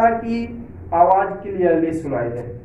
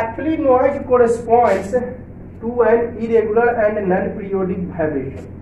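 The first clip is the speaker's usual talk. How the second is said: reads the definition slowly, word by word; slow textbook reading; 3 semitones higher, much slower than usual. Shown as a demonstration. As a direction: dictate the definition to the class. (धीमे स्वर में परिभाषा पढ़ना)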